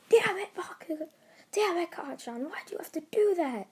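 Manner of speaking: high, strained mock yell; angry Deku yell. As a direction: act out an exasperated, high-pitched outburst. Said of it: "gets Deku even more mad, as he yelled".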